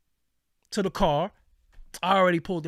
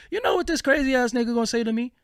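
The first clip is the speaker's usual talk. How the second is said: fast, higher, disbelieving build-up; incredulous; 6 semitones higher, 3 dB louder, faster than usual. Real, not acted. Telling the audience what the stranger said, fast and incredulous. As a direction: rush it out, amazed at him. Sets up absurd quote.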